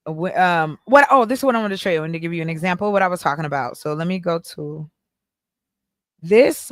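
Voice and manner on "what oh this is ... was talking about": rushing. Real, not acted. Rushing to show example.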